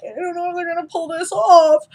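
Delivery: mock nervous suspense. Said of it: "you're like".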